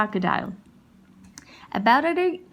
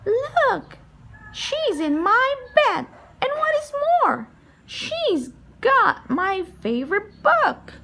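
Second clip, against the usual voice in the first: very high little bear voice; high childlike cub voice, tattling; Baby Bear; 13 semitones higher, 3 dB louder than usual. Acted. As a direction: squeak it out like a tiny cub, excited.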